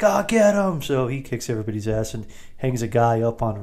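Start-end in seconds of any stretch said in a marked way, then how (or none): 0.0-0.8 higher, louder hammed-up "get him"; mock gangster order. Thugs in the gambling den, hammed-up higher order to attack. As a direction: bark it like a cartoon thug. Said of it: "And they're like".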